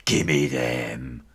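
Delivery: low, gruff bully demand; menacing bully. Acted. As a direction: growl it like a bully cat. Bully cat demands the treats.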